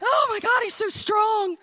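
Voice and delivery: high, breathless admiring squeal; swooning. Retold or imitated. Mom watching the exterminator lift the mattress, smitten squeal. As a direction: gush over him like a smitten girl. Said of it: "I'm like".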